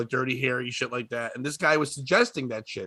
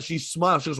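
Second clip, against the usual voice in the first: ordinary talk, nothing marked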